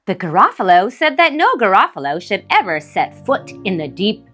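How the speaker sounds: ordinary delivery, nothing marked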